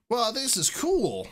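high slow wonder; high amazed Mudkip. Acted. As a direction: marvel at it like a little Pokemon.

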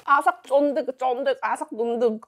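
chewing while talking; muffled words between bites; mouth full. Real, not acted. Seen 0: Lips closed and working on food, eyes shut, while serving.

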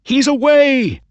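high, loud joyful shout; excited shout. A narrator's reading. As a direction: burst out as the horse breaks clear. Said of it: "cried Mister Ross".

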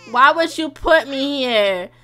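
loud, drawn-out mock protest; complaining baby. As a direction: play an upset baby's indignant complaint. Voices the upset baby's complaint.

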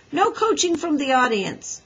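firm, high-pitched command; ordering. A narrator's reading. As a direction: order the spectators to stay out. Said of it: "ordered Scooter".

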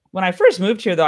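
normal talking voice

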